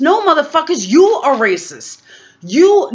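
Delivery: angry accusation. Real, not acted. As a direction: jab the blame back at them.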